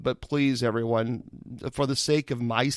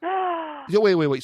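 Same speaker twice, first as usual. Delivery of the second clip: surprised; 12 semitones higher, 4 dB louder, slower than usual. Real, not acted. Host stunned by three-month wait.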